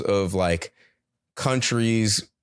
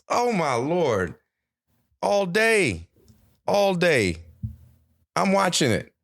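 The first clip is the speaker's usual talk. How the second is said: high excited gush; 10 semitones higher, slower than usual. Real, not acted. Gushing about watching Olympics.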